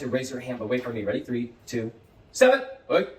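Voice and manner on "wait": usual speaking voice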